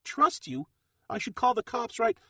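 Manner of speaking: very high, loud disbelieving shriek; incredulous fury. Acted. Lena mocking Bronco's promise, shrieking in disbelief. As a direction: throw his words back at him. Lena rejects him furiously.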